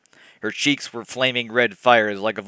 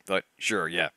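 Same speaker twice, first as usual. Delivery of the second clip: ordinary talk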